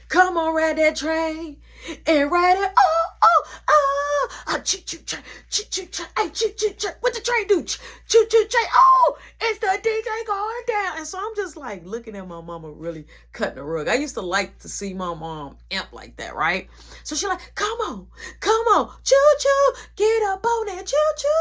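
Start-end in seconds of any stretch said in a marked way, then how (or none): 0.1-11.0 high, loud, chanting choo choo like a hype woman; wild party hype. Retold or imitated. Mom hyping the party crowd, loud, high, chanting. 17.5-21.3 high, loud, chanting choo choo like a hype woman; wild party hype. Retold or imitated. Mom hyping the party crowd, loud, high, chanting.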